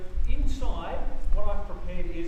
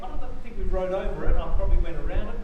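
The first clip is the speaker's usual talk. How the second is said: normal talking voice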